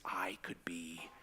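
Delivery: low, slow, hushed thought voice; hushed wondering. Retold or imitated. Storyteller voices a kid's cowboy daydream, hushed wondering. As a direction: voice a private daydream, soft and slow.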